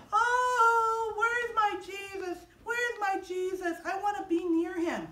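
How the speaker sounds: high, drawn-out acted wail; mock crying. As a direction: wail it slowly, as a storyteller's weeping Mary. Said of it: "she starts crying".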